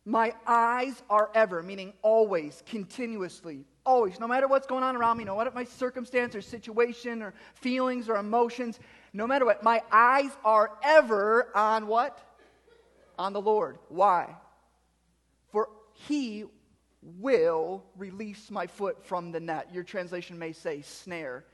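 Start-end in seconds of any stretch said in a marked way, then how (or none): 0.1-14.4 loud, higher preaching voice; punchy pulpit delivery with leaned-on key words; emphatic preaching. Real, not acted. Preaches at full force. 15.5-19.7 "will" drawn out slowly and punched; deliberate pause after "he", then a weighty "will"; stress on "will". Real, not acted. Stresses the certainty of promise.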